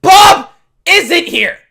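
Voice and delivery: high, very loud, escalating yell; hammed-up end-of-patience shout; exasperated shouting. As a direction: scream it at him one last time. Last exasperated telling to Jim.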